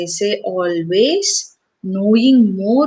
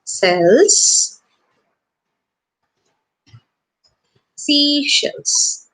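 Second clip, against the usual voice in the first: drawn-out demo; 5 semitones higher, much slower than usual. Shown as a demonstration. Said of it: "That's the previous tongue twister".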